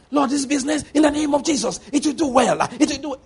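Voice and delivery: high, strained prayer declarations; mock shouting prayer. Preacher mimicking a believer praying alone, mock shouting. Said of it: "Stop shouting when you're alone".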